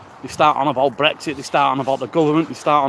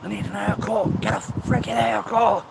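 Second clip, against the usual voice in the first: higher mock nagging voice, only mildly pushed; inner critic screaming; 6 semitones higher than usual. His head shouting at him, higher mock nag. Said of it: "screaming out to me".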